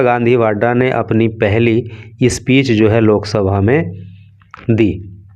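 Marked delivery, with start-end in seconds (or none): none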